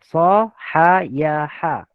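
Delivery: Sahayaha drawn out very slowly, syllable by syllable; slow pronunciation. Shown as a demonstration. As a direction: stretch every syllable of Sahayaha. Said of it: "sahaha ke?".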